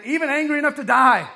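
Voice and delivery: high, very loud furious outburst; angry retort. A narrator's reading. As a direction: retort at the top of the voice. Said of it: "Jonah retorted".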